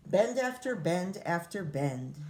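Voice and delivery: ordinary delivery, nothing marked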